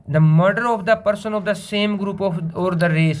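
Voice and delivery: slow, word-by-word read-out; slow dictation read. Shown as a demonstration. Teacher to students, reading the definition, slow dictation read. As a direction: read it out slowly so the class can write.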